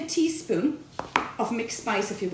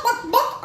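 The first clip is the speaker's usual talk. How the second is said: high mock hen clucking, much higher; a higher take on the hen's buck-buck; hen clucks; 10 semitones higher than usual. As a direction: squawk it like an excited hen. Hens clucking round the yard.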